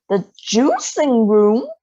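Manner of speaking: loud, slow alarmed question; alarmed cry. A narrator's reading. Mother cries out at Wonka's plan, loud and slow. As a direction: cry out in disbelief. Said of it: "cried Missus Beauregard".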